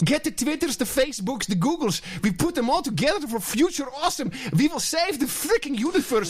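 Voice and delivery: mimicking the speaker in a high European accent; excitable hammed-up European accent; mock European accent. Host mocks the clip's speaker gushing about Twitters, mock European accent. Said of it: "Your accent is great".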